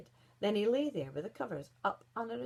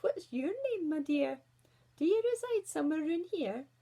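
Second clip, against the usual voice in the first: high, loud, cooing wolf; ingratiating wolf; 8 semitones higher, 5 dB louder than usual. A narrator's reading. Wolf asks the wee girl her name, ingratiating. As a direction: greet her as an over-friendly wolf.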